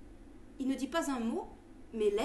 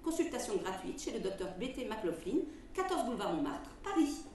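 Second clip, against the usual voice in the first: ad read; 2 semitones higher, 5 dB louder than usual. Acted. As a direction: sell it like an old radio ad. Reads a quack remedy advertisement.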